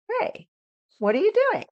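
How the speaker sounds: bright, lifted curious question, pitch rising high. Shown as a demonstration. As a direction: ask it with open, genuine curiosity. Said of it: "I sound very curious and the emotion is curiosity".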